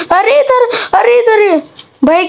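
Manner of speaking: high yelps with a laugh; startled laughing panic; high laughing alarm; panicked yelp. Real, not acted. Dragon appears, panicked.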